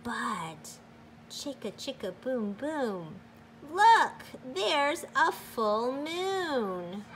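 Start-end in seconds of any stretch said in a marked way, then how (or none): 3.7-7.1 high, loud "look", then slowly "there's a full moon"; excited discovery. A narrator's reading. Reader showing children the night sky, excited then slow wonder. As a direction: point it out in wonder.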